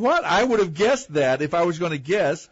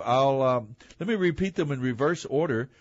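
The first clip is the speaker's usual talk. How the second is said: ordinary talk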